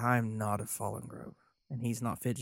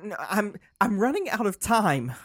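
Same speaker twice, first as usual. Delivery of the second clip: much higher, louder, pressing; desperate; 9 semitones higher, 7 dB louder than usual. Acted. Lorik desperate.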